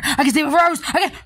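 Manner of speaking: normal talking voice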